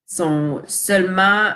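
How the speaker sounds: normal talking voice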